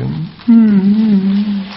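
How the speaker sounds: high, loud, drawn-out mock wail; imitated opera crying. Shown as a demonstration. Teacher showing listeners how traditional opera weeps, imitated crying. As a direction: put on a stylised stage-opera sob. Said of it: "哭的时候就".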